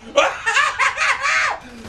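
high, manic laugh; gleeful cackle. Real, not acted. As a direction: laugh out in triumph. Gleeful at kills.

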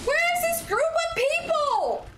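very high-pitched, incredulous yell; high squealed protest; exasperated outcry. Real, not acted. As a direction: cry out in high disbelief. Startled by enemies appearing in game.

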